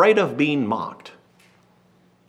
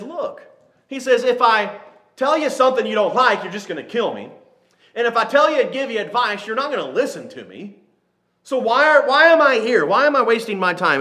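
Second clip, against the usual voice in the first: higher, pleading and fed up; exasperated prophet; 7 semitones higher than usual. Retold or imitated. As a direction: throw up your hands, why bother. Preacher acts exasperated Jeremiah.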